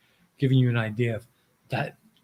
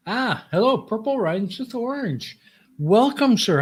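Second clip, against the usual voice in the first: excited greeting; 9 semitones higher than usual. Real, not acted. Streamer greeting a chat viewer warmly, high and excited. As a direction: greet the arriving friend with delight.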